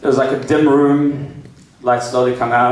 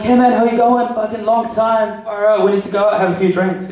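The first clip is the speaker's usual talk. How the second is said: casual mate's greeting, pitched up; 6 semitones higher than usual. Acted. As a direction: greet a long-lost mate, higher and chummy.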